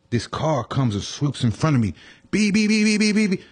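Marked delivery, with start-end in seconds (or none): 2.3-3.4 high, repeated honking beeps; imitated car horn. Storyteller imitating a swooping cab, high horn beeps. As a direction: honk insistently in a high pitch. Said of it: "start honking their horns".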